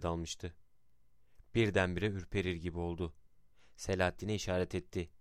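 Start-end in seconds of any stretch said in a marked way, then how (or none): none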